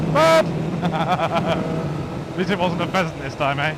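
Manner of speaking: slow laughing words. Real, not acted. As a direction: chuckle through it slowly. Laughing about pheasant.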